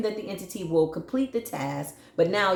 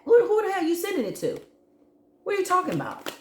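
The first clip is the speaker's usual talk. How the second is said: much higher, fast and indignant; incredulous outburst; 13 semitones higher, much faster than usual. Real, not acted. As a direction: shriek it in disbelief.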